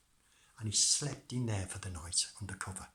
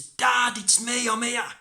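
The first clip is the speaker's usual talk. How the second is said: calling out; 12 semitones higher, 11 dB louder than usual. Acted. Tom calling to dad.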